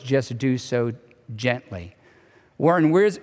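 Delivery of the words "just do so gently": softer and lower on the last words; gently. Shown as a demonstration. Pastor urging believers to speak truth kindly, soft and tender. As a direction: let the word gently fall soft and tender.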